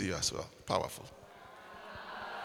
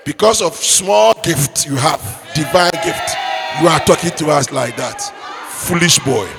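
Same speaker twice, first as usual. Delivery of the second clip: scolding mother; 2 semitones higher than usual. Acted. Angry mother warning her son after church. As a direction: lay into the foolish boy.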